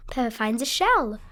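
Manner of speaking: ordinary delivery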